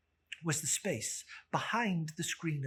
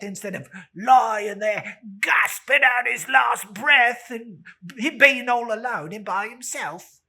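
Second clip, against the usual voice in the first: loud, high, contemptuous rant; scornful charwoman tirade; 7 semitones higher, 12 dB louder than usual. Acted. Charwoman railing at the dead man's meanness, loud and scornful. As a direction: spit out your scorn for the dead miser.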